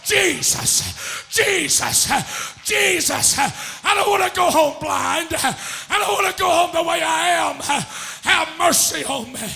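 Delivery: loud, high revival preaching with gasps between phrases; hard, rhythmic pulpit shouting; full-force preaching. Real, not acted. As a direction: shout the Bible story in driving cadence.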